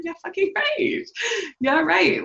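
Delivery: high-pitched, incredulous dismissal; sarcastic scoff. Acted. Woman rejecting constant happiness, sarcastic scoff. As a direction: scoff at a ridiculous idea, voice jumping up. Scoffing at always being happy.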